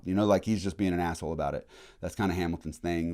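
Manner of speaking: normal talking voice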